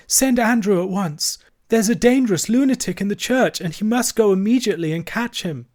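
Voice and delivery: high-pitched, urgent wifely command; alarmed order. A narrator's reading. Vicar's wife ordering the maid about, alarmed. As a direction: send for help in a fluster.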